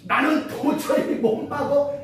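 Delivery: high, drawn-out mock protest; exasperated protest. Someone unable to hold the tongue, acted exasperation.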